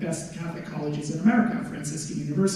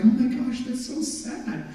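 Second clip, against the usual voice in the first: put-on high, slow gushing girl voice; mock sympathetic girls; 7 semitones higher, slower than usual. Girls reading his sad poems, high slow gushing. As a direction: coo it like a pitying schoolgirl. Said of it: "all the girls would read my poems and be like".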